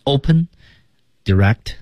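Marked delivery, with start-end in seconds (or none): 0.1-0.5 open, rising lift. Shown as a demonstration. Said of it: "一种开放的一种意识，wide open".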